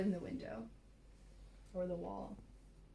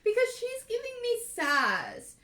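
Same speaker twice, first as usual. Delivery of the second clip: much higher and louder, slow through laughter; laughing while talking; 14 semitones higher, 12 dB louder than usual. Real, not acted. Complaining about the sassy cat, laughing.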